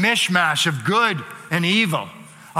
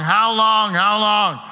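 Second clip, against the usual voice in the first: louder, higher repeated cry; 4 semitones higher, 5 dB louder, slower than usual. Retold or imitated. Preacher voicing the psalmist crying out, louder. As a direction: wail it three times, each pleading. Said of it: "the psalmist is crying out".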